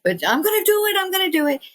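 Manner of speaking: high-pitched, insistent mimicry; imitated stubborn mother. Mimics stubborn mother answering brother.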